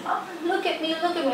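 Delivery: put-on high eager little girl calling; excited child. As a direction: show off to mum like a proud kid. Daughter voiced, proud.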